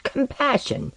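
high-pitched, disbelieving question; incredulous echo. Acted. Osden challenges Tomiko on compassion, incredulous. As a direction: throw the word back at her, pitched high.

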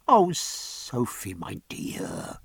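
soft, slow and gentle; gently. A narrator's reading. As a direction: reach her tenderly, softly. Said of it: "he said gently".

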